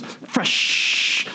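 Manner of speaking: Fresh, drawn out super long in a breathy mock voice; stretched out fresh. Audience member in back of the room, a long drawn-out Fresh,. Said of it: "and went super long".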